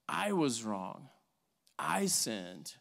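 low, slow, humble admission; repentant confession. Acted. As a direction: own up quietly and gravely. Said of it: "But repentance says".

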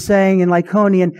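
ordinary delivery

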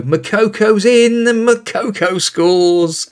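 high, drawn-out commentator goal call; excited goal call. Real, not acted. His striker scores.

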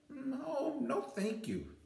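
high, quick polite refusal; little girl. A narrator's reading. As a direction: hug him close and refuse. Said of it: "Lisa answered".

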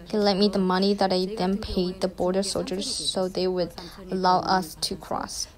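ordinary talk

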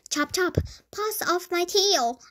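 high, mild storybook shriek. A narrator's reading. Mouse defending his tail from clippers, mildly shrieking. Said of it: "I shrieked".

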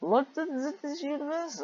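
high, incredulous squeak at the page; mock disbelief. Real, not acted. Reader squeaks in mock disbelief at the story. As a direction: play dumb, way up high. Mocking the fanfic's setting.

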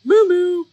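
two punchy moos like a horn; honking moo. Acted. Reader asks children what it sounds like, loud honking moos. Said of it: "What does that sound like?".

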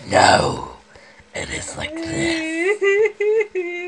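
distorted demon growl. Pretend. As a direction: play a monstrous demon. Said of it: "I love my demon voice".

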